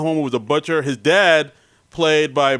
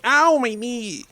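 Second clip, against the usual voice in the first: high, slow, whiny 'ow my knee'; whiny hurt voice; 8 semitones higher, much slower than usual. Pretend. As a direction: play up a wimpy injury. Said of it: "that's how I sound when I'm hurt".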